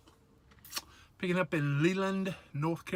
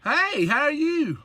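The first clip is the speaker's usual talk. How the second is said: higher, louder, slower hello; loud bright greeting; 10 semitones higher, 7 dB louder, slower than usual. Real, not acted. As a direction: call out to the caller cheerily. Greeting telemarketer on speakerphone.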